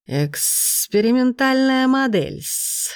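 slow, smug hissing snake; pleased serpent. A narrator's reading. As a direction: stretch it out like a proud serpent. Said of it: "довольно пояснил змей".

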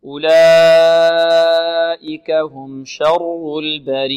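slow, drawn-out melodic recitation; Quran recitation. Shown as a demonstration. Teacher models the verse slowly for the student to repeat.

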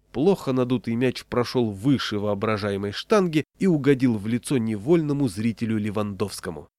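plain reading voice

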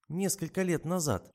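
smiling. A narrator's reading. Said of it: "улыбнулась девица".

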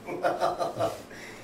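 brief airy chuckle; a light amused laugh; soft laugh. Real, not acted. Laughs about being Exu's daughter.

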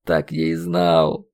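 slow, low, grieving resignation; sorrowful. A narrator's reading. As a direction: say it heavy with sorrow. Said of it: "со скорбью в голосе". (скорбно, обречённо)

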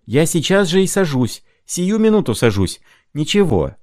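high, excited promise; high eager voice; eager outburst. A narrator's reading. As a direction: rush it out keenly. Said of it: "закричал Шумков".

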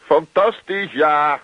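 high, loud forced enthusiasm; geforceerd enthousiast. Acted. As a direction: force the cheer through clenched panic. Henk forced enthusiasm, strained. (hoog en luid, gespeeld enthousiast)

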